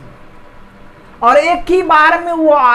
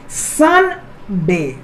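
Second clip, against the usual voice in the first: Sunday pulled apart with a clear gap, सन … डे; very slow Sunday in two beats, सन and डे; syllable by syllable; 6 semitones lower, much slower than usual. Shown as a demonstration. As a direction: demonstrate both syllables of Sunday, pausing after सन. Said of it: "दो syllable आ रहा है".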